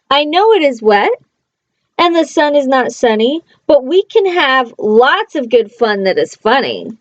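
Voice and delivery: lively read-aloud, playful bounce on the rhymes; reading to children. Acted. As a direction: read it like a bedtime picture book. Reading a picture book aloud.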